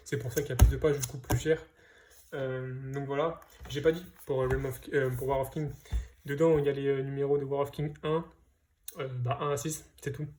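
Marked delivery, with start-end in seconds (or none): none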